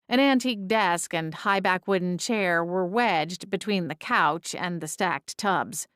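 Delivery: ordinary delivery, nothing marked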